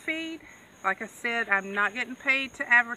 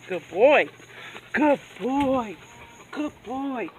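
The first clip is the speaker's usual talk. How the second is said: louder, eager praise for the dog; 6 dB louder, slower than usual. Real, not acted. Owner praising the dog for staying close to the goat, loud.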